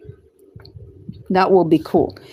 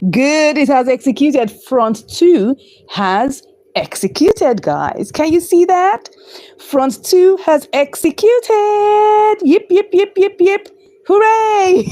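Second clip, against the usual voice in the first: excited cheering; 8 semitones higher, slower than usual. Real, not acted. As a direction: cheer it out, voice jumping up. Trade executed, cheering.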